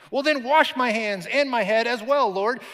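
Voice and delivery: high, loud eager outburst; exclaiming. Acted. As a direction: blurt it out overeager.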